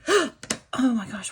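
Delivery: loud hiccup then slow surprise; talking through a hiccup. Real, not acted. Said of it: "why do I have the hiccups".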